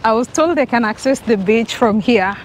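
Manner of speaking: ordinary delivery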